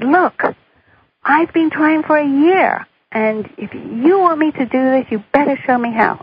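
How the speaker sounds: telling off the universe, exasperated; angry outburst. Retold or imitated. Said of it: "And I was mad. I said".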